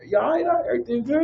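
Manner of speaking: soothing. Retold or imitated. Easing a tense stranger.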